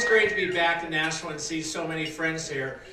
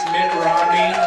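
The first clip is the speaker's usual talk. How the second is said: rally shout; 9 dB louder, slower than usual. Real, not acted. Rally crowd announcement of nominee.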